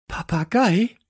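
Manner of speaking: high, loud incredulous question; gasped disbelief. Acted. Teacher stunned to hear of a parrot, high gasp. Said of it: "keuchte sie dann".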